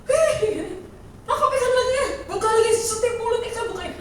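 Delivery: high, loud words through laughter; laughing crowd. Retold or imitated. Disciples laughing at the coin in the fish's mouth, high and loud. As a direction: laugh it out like amused onlookers. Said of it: "Orang ketawa".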